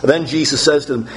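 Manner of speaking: normal talking voice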